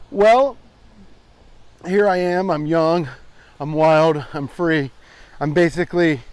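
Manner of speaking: loud, mock-heroic boasting; booming mock swagger to the camera; hyped-up bravado. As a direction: pump yourself up like a hammed-up champion. Hyped-up self-promo on a trail walk.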